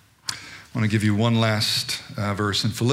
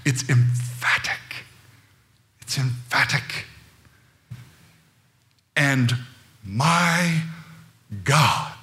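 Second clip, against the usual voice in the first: weighty, word-by-word emphasis with long pauses; emphatic; 3 semitones higher, slower than usual. Shown as a demonstration. Preacher showing the church how emphatic the verse is, emphatic. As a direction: punch each word and let it hang. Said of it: "Please hear the emphaticness of verse nineteen".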